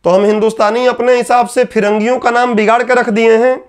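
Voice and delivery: ordinary talk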